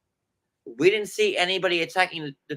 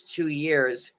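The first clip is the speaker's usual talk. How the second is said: usual speaking voice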